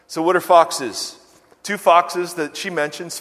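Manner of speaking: ordinary talk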